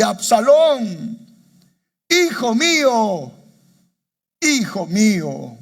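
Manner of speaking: high, loud and slow, a father's wailing cry; weeping lament. Acted. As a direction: cry out each name as if grief breaks the voice. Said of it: "y lloró, y yendo decía así". (lamento llorado, agudo, fuerte y lento)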